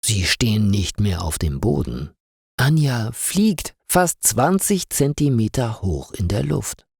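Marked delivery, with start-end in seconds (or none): none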